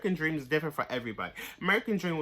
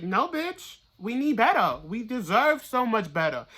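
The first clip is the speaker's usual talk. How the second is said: higher, louder, firm "no, bitch"; defiant no; 4 semitones higher, 5 dB louder than usual. Real, not acted. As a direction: refuse it outright, chin up. Rejecting poor treatment.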